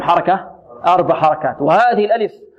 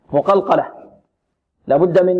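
ordinary talk

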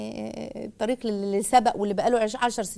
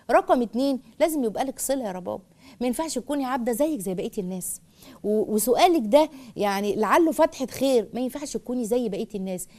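usual speaking voice

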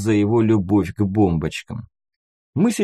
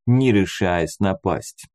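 plain reading voice

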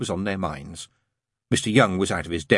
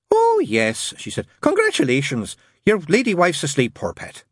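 much higher, gushing nun voice; 8 semitones higher than usual. A narrator's reading. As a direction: gush brightly up high as the nun. Nun congratulating the new father.

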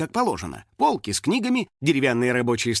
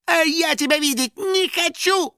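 very high, squeaky retort; Финик; 14 semitones higher than usual. A narrator's reading. Финик answering the hunter chasing him, high-pitched retort. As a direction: call back in a high creature voice. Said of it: "откликнулся Финик".

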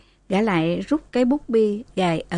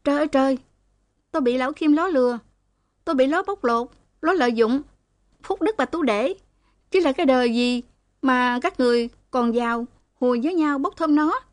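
higher, frantic lament; wailing cry; 5 semitones higher, faster than usual. A narrator's reading. Girl wails against Kim to the women, high and frantic. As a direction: howl it out like a cat in the night. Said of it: "nó gào".

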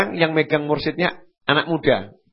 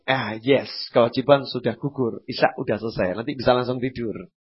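smug relief; 6 semitones lower, 5 dB quieter than usual. Retold or imitated. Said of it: "Dia akan mengatakan".

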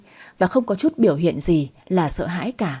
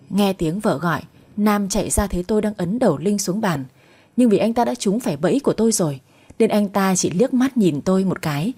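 ordinary delivery, nothing marked

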